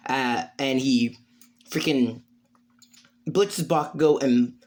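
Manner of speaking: ordinary delivery, nothing marked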